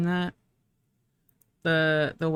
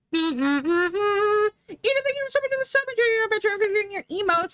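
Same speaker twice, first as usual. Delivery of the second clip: bright sub thank-you; 4 dB louder than usual. Real, not acted. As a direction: gush thanks at the new sub. Thanking subscriber, announcer-like.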